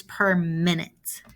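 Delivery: per minute, slow, leaning hard on minute; slow right down and hit minute; stress on minute. Shown as a demonstration. Teacher stressing the unit minute to students, slow and deliberate. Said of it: "I'm trying to emphasize that word minute".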